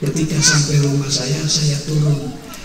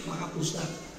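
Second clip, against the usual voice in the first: hushed confession; 10 dB quieter than usual. Acted. Young man whispering his worst sin to the preacher, hushed and afraid. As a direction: drop to a near-hush, afraid to say it.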